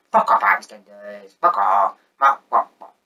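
usual speaking voice

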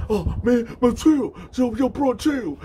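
high, loud acted yelps; retold frantic begging off an attacker; squealed mock pleas; pleading to stop. Punched man begs him off.

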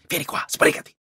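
whisper. A narrator's reading. Denise hurries him whispering.

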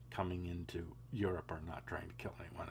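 fast whispered disclaimer; quick whisper. Real, not acted. Host whispers a hurried disclaimer to viewers, soft and fast. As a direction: rush it out under your breath. Hedged aside.

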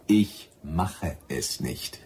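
each word set down slowly and firmly; slow firm refusal. Acted.

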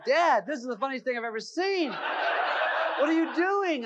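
speaking through helpless laughter, high and drawn out; laughing son. Retold or imitated. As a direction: imitate the son who cannot stop laughing. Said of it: "He goes".

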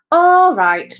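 high, loud "all right"; teacher calling attention. A narrator's reading. Teacher calls rehearsal to start.

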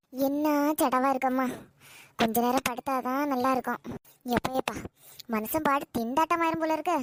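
put-on low, soft, tired old woman grumbling to herself; weary old mother. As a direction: sigh out an exhausted old mother's complaints.

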